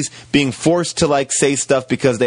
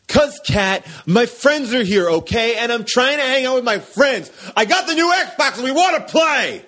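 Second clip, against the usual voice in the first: higher, whining husband defending his game night; whiny husband; 9 semitones higher than usual. Acted. Husband whining to Kat about his friends, higher. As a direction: whine it like a grown man caught slacking. Acted quarrel with wife Kat.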